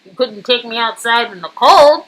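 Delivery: ordinary talk, nothing marked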